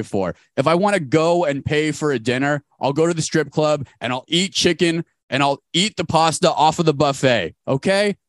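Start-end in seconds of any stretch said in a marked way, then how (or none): none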